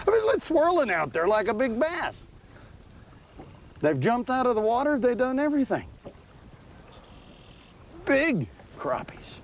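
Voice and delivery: high, drawn-out excited fishing call. Real, not acted. Angler to his partner about big crappies jumping, excited.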